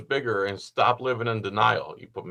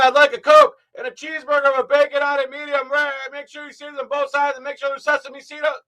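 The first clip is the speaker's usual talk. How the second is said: shouting everything, much higher and louder, even a food order; mock caps-lock yelling; 14 semitones higher, 11 dB louder than usual. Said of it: "considered yelling".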